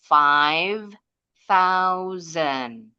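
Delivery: FIVE, pause, THOUSAND with th and z; contoh pelafalan. Shown as a demonstration. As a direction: model FIVE THOUSAND word by word, clearly. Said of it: "seperti itu pengucapannya". (FIVE THOUSAND pelan, th jelas, s berbunyi z)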